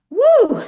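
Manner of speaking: loud, high-pitched "woo"; excited whoop. Real, not acted. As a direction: cheer with one big ringing whoop. Host cheers the powerful story.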